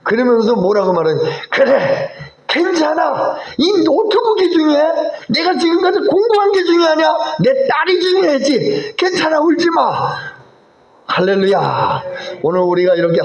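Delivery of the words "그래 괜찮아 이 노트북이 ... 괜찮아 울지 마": put-on high-pitched, emotional dad consoling his child; relenting father. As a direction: act out a tearful-sounding, impassioned reassurance. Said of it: "마음이 다 풀려버렸어요". (울먹이듯 달래는 아빠 목소리)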